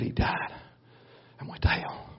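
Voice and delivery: hushed whisper; whispered gravely. Real, not acted. Sermon climax whispered.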